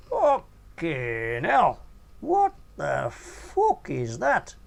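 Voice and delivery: slow, loud, high horrified gasp; Nobby recoiling at the sight, loud and drawn out; choked shock. A narrator's reading. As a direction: choke it out in disgust. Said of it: "choked my mate Nobby".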